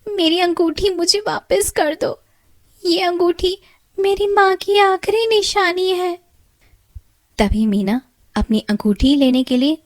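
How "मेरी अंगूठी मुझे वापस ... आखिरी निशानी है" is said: much higher tearful plea; high weeping girl voice; crying. Acted. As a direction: beg the fish through tears. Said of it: "तभी मीना रोते हुए मछली से कहती है".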